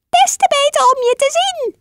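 wolf in grandma's bed squeaking sweetly; squeaky granny voice. Acted. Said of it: "piepte de wolf".

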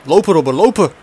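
building from call to shout; urging yell. Real, not acted. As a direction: yell at him to run. Urging player to run.

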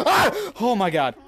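high loud shriek into gasped words; scream, then cry out in shock; real jump-scare yell; startled scream. Real, not acted. Jump scare mid-question.

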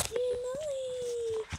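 high, slow sing-song cheer; drawn-out squeal of delight; excited cheer. Real, not acted. Cheering the dog.

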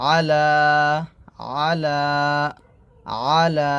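আলা with the final a drawn out; measured আলা, lengthened at the end; one-beat elongation. Shown as a demonstration. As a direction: hold the last vowel of আলা for a beat. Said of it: "এক লিপ টান দিবেন".

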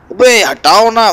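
high, loud, slow frantic yell. Acted. Being rammed on the road, frantic loud yell. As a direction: shove them out of the way.

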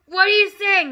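high, loud outburst; alarmed cry. Acted. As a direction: cry out in horrified disbelief. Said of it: "the husband cried".

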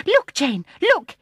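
high, loud excited call; crying out. A narrator's reading. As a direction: shout it, breathless and urgent. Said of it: "he cried".

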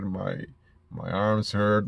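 ordinary talk

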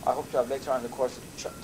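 ordinary delivery

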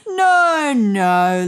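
high, loud fond protest; a man waving off the idea; Louis protesting. A narrator's reading. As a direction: brush it off like a doting owner. Louis voiced in story.

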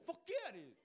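proud yes. Retold or imitated. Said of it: "you go".

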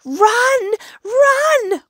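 high, loud drawn-out shouts; acted alarm yell; panicked cry. A narrator's reading. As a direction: shout a terrified warning. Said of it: "cried Hedgehog".

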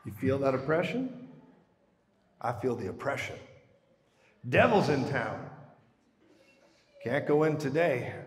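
low, hushed, mock-cautious Jesus turning back from the city; hesitant mock Jesus. Preacher mocks a timid Jesus who fears the devil, low and hushed. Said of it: "He's like".

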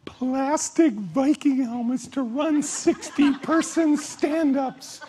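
over-the-top excited colleague pitching helmets; gushing pitch. Pretend. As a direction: sell the wild idea with big enthusiasm. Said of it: "and you're like".

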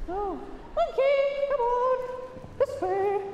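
high, lifted recall call; calling a dog. Real, not acted.

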